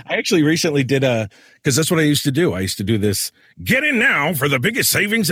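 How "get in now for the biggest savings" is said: high-pitched radio commercial pitch voice; weird voice. Shown as a demonstration. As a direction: put on a weird, high ad-man voice. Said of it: "all this weird voice".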